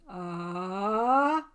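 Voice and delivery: loud startled cry; a sudden cry from off; scream. Acted. Scream heard from living room.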